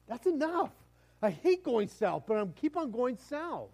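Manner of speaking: high, loud acted protest; exasperated outburst. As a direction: cry out that you've had enough. Acts out stubborn refusal to stop.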